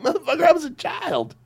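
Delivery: high, fast mock outrage. Grown kid yelling at filmmakers who lied, high mock outrage. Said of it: "It was like".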